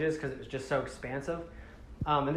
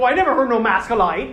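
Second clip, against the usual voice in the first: louder, higher mock Irish accent; 6 semitones higher, 9 dB louder, faster than usual. Le-Matoran answers the visitors, loud Irish accent. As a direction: protest in a comic Irish lilt. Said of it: "Irish accents for you guys".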